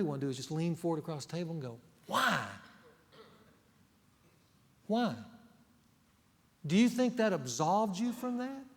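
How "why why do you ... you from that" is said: sharp, higher pointed questioning; incredulous challenge. Retold or imitated. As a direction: lean in and demand why. Pastor voicing imagined rebuke.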